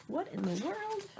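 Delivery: confused dad. Retold or imitated.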